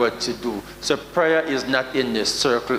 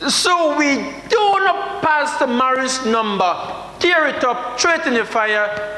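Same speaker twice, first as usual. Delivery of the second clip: excited retelling; 10 semitones higher than usual. Retold or imitated. Retold burning of phone.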